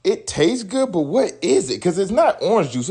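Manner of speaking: put-on high, puzzled young kid; wondering aloud in a high kid voice; confused kid voice. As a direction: sound like a baffled child tasting it. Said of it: "I'd be like".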